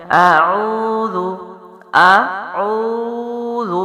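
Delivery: اعوذ slow, lips rounded, long و; tajweed recitation. Shown as a demonstration. Teacher modelling the word for students, slow chanted recitation. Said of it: "تو ادائیگی کیا ہے؟".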